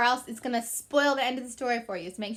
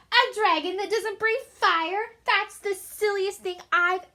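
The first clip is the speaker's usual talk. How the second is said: mocking knight's father; 6 semitones higher than usual. A narrator's reading. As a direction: scoff at the silly idea. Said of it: "the shiny man laughed".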